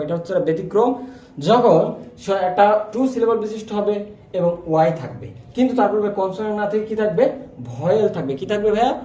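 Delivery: ordinary talk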